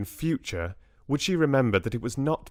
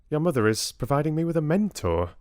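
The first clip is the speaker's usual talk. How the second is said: put-on higher, quicker young girlfriend; Bella, brightly informative; 7 semitones higher, faster than usual. Bella tells Richard about his mother's plan, higher and brisk. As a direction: pass on news in a higher, brisk voice.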